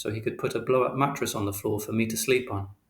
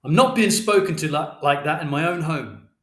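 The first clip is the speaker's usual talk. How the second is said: loud, higher-pitched outrage; indignant shout; 5 semitones higher, 6 dB louder than usual. A narrator's reading. Mister Shears to Father in his own home, shouting. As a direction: raise your voice in offence. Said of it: "Mister Shears shouted".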